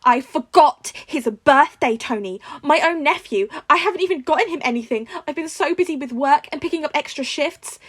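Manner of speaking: high, loud guilty outburst; loud and upset, near tears; aunt confessing her guilt, high and loud; distraught. A narrator's reading. May distraught on the phone.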